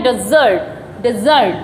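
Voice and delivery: डेजर्ट said as "de-ZURT", falling; teacher models डेजर्ट with a strong z; word demo. Shown as a demonstration. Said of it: "इसको हम बोलते हैं".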